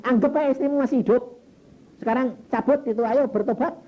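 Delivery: loud, high urging; rousing retold command; acted urgent exhortation. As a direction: press him forcefully to act now. Retold pastor urging repentance.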